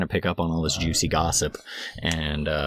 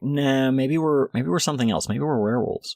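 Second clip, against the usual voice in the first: pitched-up speculative dismissal; doubtful denial; 7 semitones higher than usual. Retold or imitated. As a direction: float other theories in mock disbelief. Acted doubting vampire denies being one.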